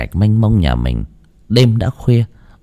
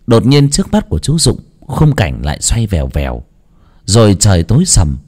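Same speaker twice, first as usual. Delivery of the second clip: ordinary delivery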